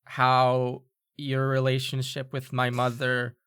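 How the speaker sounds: very slow, halting delivery with pauses; halting forced apology. Acted. Student made to apologize to the one she glares at, stiffly halting. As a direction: drag out each phrase of a stiff apology.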